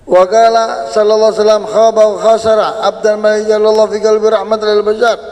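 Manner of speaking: loud chanted recitation of the Arabic hadith. Acted. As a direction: proclaim the hadith like from the pulpit.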